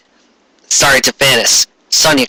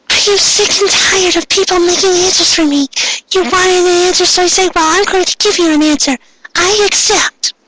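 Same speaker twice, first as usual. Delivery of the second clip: put-on much higher girl's voice, bitter and defiant; furious Ami. Ami accepting the villain's offer, high defiant girl voice. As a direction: spit out her acceptance in a high girlish voice.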